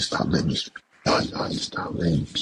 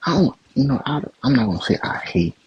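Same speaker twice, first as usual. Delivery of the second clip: worked-up; 8 semitones higher, 4 dB louder than usual. Real, not acted. Angry rant at the pundit.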